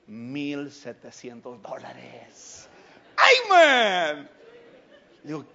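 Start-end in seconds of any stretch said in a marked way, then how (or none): 3.2-4.3 high, loud cry of joy; he exclaims with joy after selling the car; joyful exclamation. Real, not acted. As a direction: burst out with delight.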